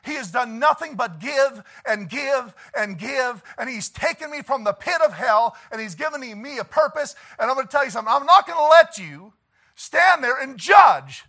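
high, loud preaching building to a shout; impassioned defence. Acted. Defending his Lord, building to shout.

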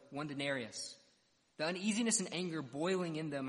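ordinary talk

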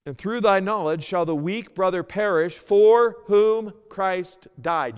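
loud, high, slow preached verse; slow fervent proclamation; solemn scripture reading. Acted. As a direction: make them hear every word. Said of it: "Because listen with me".

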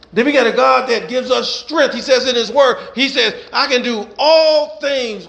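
rhythmic preacher cadence, building; preaching. Acted. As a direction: preach it to the congregation with fire. Preaching to congregation.

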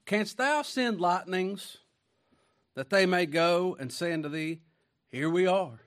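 lifted voice, stretched phrases; scripture declamation. Real, not acted. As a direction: declaim it slowly as a grand challenge. Reads God's challenge to Job.

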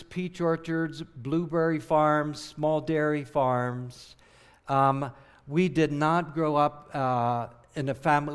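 ordinary talk

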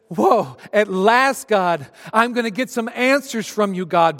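finally getting answers, overjoyed; excited Job. Acted.

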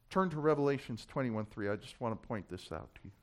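ordinary delivery

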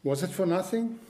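ringing preacher's question. Acted. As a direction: call the question out to the congregation. Rhetorical question to congregation.

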